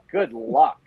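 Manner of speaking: ordinary talk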